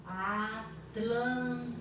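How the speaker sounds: Atlântico stretched into slow syllables, At-lân-ti-co. Shown as a demonstration. Teacher to pupils, syllabifying slowly. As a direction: sound out Atlântico slowly, one syllable at a time. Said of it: "Estou separando em sílabas".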